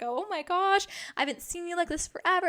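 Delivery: can't believe how new she looks; excited gush. Retold or imitated. Boyfriend Zach delighted by her short hair, gushing. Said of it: "You're like".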